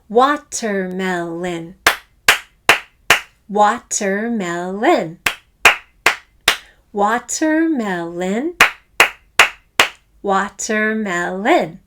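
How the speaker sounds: crisp T, slow even beats; slow syllable-by-syllable watermelon. Shown as a demonstration. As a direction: say "Watermelon" slowly, one clap per syllable. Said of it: "emphasize the T in the middle".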